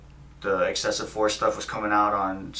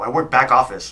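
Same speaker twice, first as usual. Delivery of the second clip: louder, irritated retort; retold snapping; 2 semitones higher, 7 dB louder than usual. Tired driver answering the cop's questions, snapping. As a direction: snap back at needless questioning. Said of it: "and I snapped at him".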